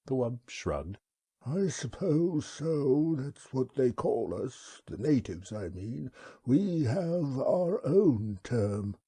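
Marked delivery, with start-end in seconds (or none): 1.5-9.0 slow, high, unhurried alien creature voice; wub's voice. Acted. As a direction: speak as a placid, courteous old beast. The wub speaks.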